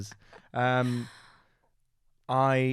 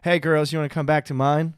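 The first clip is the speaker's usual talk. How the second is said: louder, higher American chat-up voice; raised, punchy American-accented come-on; 4 semitones higher, 7 dB louder than usual. Retold or imitated. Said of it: "I just did an American accent".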